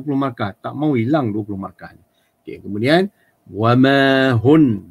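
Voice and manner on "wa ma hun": wa ma hun very slow, the final n cut off; stopped ending. Shown as a demonstration. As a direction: say hun and stop dead, no vowel after. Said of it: "Jangan baca wa ma hunna". (wa ma hun, matikan hujung, perlahan)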